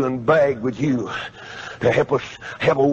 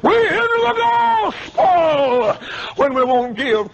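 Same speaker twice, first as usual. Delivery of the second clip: high, slow shouted sermon line; preaching at full force; 3 dB louder, slower than usual. Acted. Preacher charging the congregation about giving, shouting. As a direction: ring it out as the final charge.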